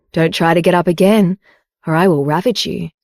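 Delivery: usual speaking voice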